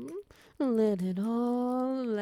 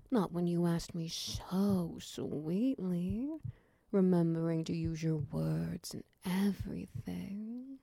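ordinary talk

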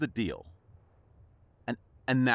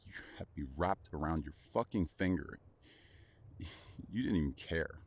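wounded; 8 semitones lower, 9 dB quieter than usual. Acted. Hurt after betrayal.

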